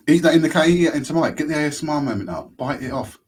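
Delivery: loud high eager urging; excited. Real, not acted. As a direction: egg him on loudly. Urging co-host to bite.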